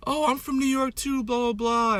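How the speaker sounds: high, singsong chirp imitating a friend; mock eager friend. Voicing Maddie greeting another New Yorker.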